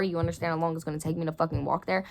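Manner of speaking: ordinary delivery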